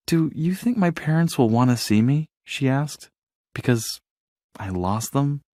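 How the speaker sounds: quietly worried whisper of doubt; nervous small voice. A narrator's reading. Dory anxious.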